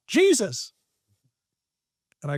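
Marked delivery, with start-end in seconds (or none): 0.1-0.7 high-pitched imitation of a call-out; mock yell. Audience member answers the trainer's question, mock yell. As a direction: call it out high without real volume. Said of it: "someone yells out".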